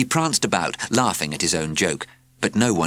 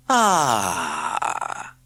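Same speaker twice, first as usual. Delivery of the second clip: sighing cat. A narrator's reading. Cat sighs dreamily over her pen friend's note. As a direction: sigh over the letter. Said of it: "she sighed".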